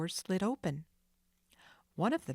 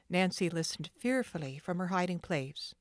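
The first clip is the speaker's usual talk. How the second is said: usual speaking voice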